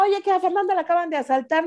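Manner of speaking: high, rushed mock panic; retold hysteria. Father telling mother the daughter was just mugged, retold hysteria. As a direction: imitate a frantic father raising the alarm. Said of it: "me puse histérico, loco".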